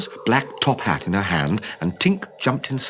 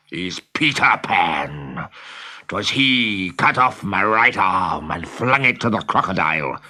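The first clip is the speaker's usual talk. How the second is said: growling Hook. Acted. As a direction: growl it like a villain savoring revenge. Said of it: "growled Hook".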